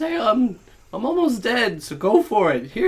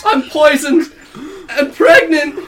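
laughing cry; 14 semitones higher, 11 dB louder, slower than usual. Acted. Dying character cries out.

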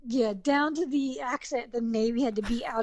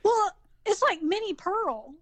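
higher-pitched Southern accent; 7 semitones higher than usual. Real, not acted. Guest shows her old accent slipping back, Southern accent. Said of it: "there's the accent, right?".

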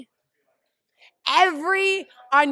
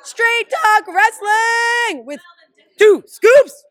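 high, loud, drawn-out show intro; announcer shout; 11 semitones higher, 13 dB louder, much slower than usual. Pretend. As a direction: belt it out like a ring announcer. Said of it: "just tell the people who they're listening to".

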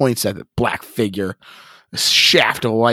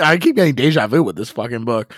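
usual speaking voice

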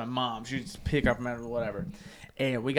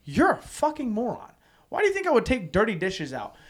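higher mock voice of the wife; 9 semitones higher, 5 dB louder than usual. Wife scolding him over the dishes, higher mocking voice. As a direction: sneer it like his wife did. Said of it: "She's like".

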